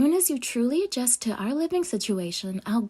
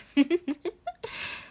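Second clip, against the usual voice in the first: pleased little giggle; light laugh; 6 semitones higher than usual. Acted. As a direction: laugh off the compliment sweetly. Flattered chef laughs at compliment.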